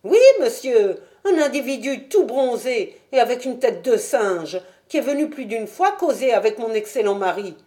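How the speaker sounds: a chatty wife describing a strange visitor; Mrs Smith. Acted. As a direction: spill the gossip eagerly. Landlady voiced by narrator.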